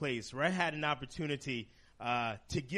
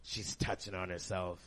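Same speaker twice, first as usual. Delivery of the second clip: lower, softer mock prim nurse voice; mimicking the nurse; 7 semitones lower, 5 dB quieter than usual. Comic repeating the nurse's exact phrasing, low and soft. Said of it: "she said it like that".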